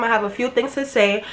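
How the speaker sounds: usual speaking voice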